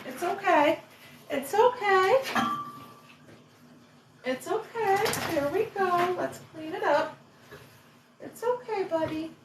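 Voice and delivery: high, soft reassuring coo; calm a sick puppy gently. Real, not acted. Comforting dog after vomiting.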